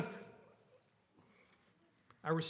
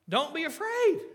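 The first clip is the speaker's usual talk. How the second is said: higher and louder, firm comfort; emphatic reassurance; 10 semitones higher, 6 dB louder than usual. Acted. Jesus comforting frightened disciples, emphatic and loud. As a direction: call it out over the storm.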